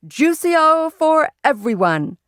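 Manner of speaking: slow, high, cheery dad voice; cheerful father. A narrator's reading. Father treats everyone, cheery and slow. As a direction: announce the treat grandly to the guests.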